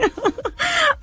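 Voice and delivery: high giggling burst; short falling giggle; real laugh. Real, not acted. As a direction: laugh delightedly at the coincidence. Laughs about Patna coincidence.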